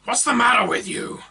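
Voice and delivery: high, loud, rough yet friendly; rough but friendly. Acted. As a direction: scold him kindly. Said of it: "Its voice was rough, but friendly".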